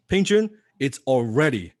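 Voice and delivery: ordinary talk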